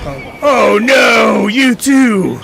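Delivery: very loud, high, slow cry of dismay; dismayed shout. Real, not acted. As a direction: wail as your teammate falls. Teammate goes down in game.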